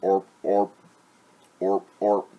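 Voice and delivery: loud, short frog croaks. Acted. Frog leaping by the well, loud croaking. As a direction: croak loudly in pairs like a frog.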